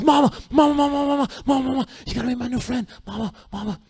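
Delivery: put-on high, fast, eager child calling for mom; a little boy bursting to show off his new friend; excited kid. Little boy calls his mom over to meet his friend, high and eager. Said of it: "He goes".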